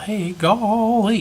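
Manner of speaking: high, slow hammed-up Southern drawl; mock Southern. Comparing villain accents, mock Southern drawl. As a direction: drawl it like a country boy. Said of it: "someone from the South".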